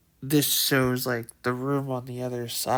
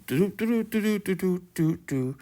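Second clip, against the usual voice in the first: doop sound effect; 5 semitones higher than usual. Retold or imitated. Imitating game footstep sounds.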